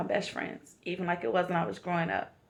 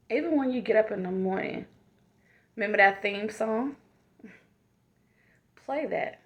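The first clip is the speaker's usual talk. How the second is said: motivational affirmation. Acted. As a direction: speak each line like a loving reminder. Affirmation talk to the viewers.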